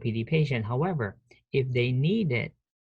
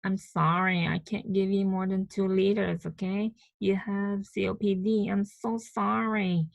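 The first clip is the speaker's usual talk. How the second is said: high-pitched mock-apologetic nurse voice; 7 semitones higher than usual. Nurse refusing more oxygen to a gasping COPD patient, high, apologetic role-play voice. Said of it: "the nurse comes and tells Jenna".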